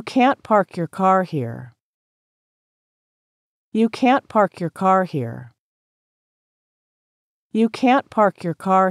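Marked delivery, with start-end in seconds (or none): none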